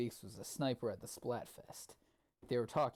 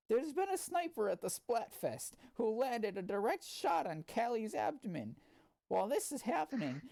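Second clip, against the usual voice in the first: high, hammed-up news anchor voice; a mock newscaster, pitched up; news reporter; 8 semitones higher than usual. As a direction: read it like an over-eager TV reporter.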